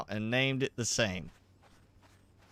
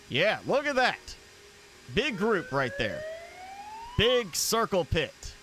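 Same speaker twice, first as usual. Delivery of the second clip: hyped up; 10 semitones higher than usual. Real, not acted. Gamer hyping a big enemy group.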